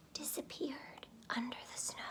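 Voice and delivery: very soft whisper; hushed snowy whisper. A narrator's reading. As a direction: fade into a whisper. Hushed narration.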